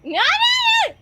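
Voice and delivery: high, loud no no no; loud protest. Real, not acted. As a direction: shout it down in a panic. Panicked refusal at the table.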